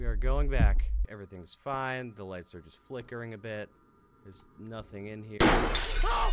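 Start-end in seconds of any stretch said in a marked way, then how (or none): 1.1-5.4 hushed, talking yourself calm; soft nervous reassurance; quiet self-reassurance. Real, not acted. Self-reassuring while scared. 5.4-6.3 sharp high shriek of fright; startled scream. Real, not acted. Scared scream in game.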